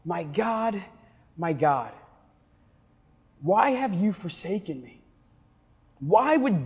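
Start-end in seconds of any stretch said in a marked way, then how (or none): 0.0-5.0 softer, hushed and anguished, words spaced apart; hushed cry. Acted. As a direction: pastor voicing Jesus's cry as a hushed lament. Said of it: "where Jesus shouts".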